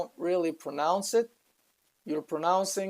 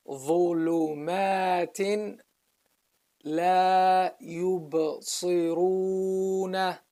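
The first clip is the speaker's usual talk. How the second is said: Quran recitation. Shown as a demonstration. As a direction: recite in measured, sustained syllables. Teacher recites the verse.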